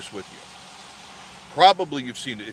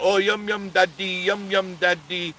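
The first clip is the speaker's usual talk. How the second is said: higher, delighted yum yum daddy; giddy cooing; 7 semitones higher than usual. Retold or imitated. Princess cooing to daddy while eating, giddy and higher. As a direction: coo at daddy like a thrilled girl.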